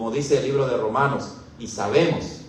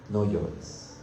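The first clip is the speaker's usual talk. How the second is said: "no llores" repeated lower and much softer; soft tender repeat; 7 semitones lower, 7 dB quieter than usual. Acted. The elder gently hushes John's weeping, soft and tender. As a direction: let "no llores" fall away softly, like soothing a child.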